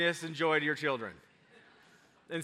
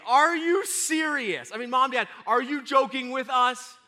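high, insistent imitation of doubtful children; loud, pitched-up child disbelief; doubting kids; 9 semitones higher, 8 dB louder than usual. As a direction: question it like kids afraid of being teased. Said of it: "some of their enthusiasm died off".

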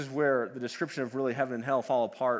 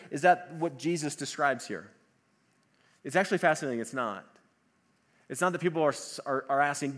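usual speaking voice

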